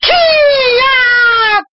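very high, long karate shriek; battle cry. Acted. Narrator acts her attack on creatures, battle cry. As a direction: let out a piercing attack yell.

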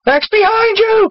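high, drawn-out warning cry; cried warning. A narrator's reading. Teen warns Max of a skull behind, crying out. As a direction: call out a slow, high-pitched alert.

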